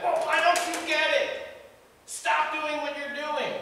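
frustrated friend yelling to stop; exasperated yell. Retold or imitated. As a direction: snap at them to get it. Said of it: "yelling at them".

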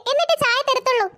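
ordinary talk, nothing marked

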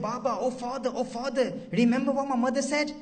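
put-on high, loud, desperate child's cry; little girl calling out to her father from the ditch; pleading little girl. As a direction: plead upward like a frightened daughter. Buried daughter pleads with father.

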